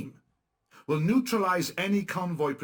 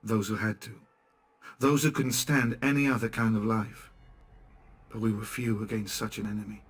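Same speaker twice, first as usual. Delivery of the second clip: story narration; 3 semitones lower, 4 dB louder than usual. A narrator's reading. Narrates the resistance's beginnings.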